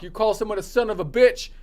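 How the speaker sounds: usual speaking voice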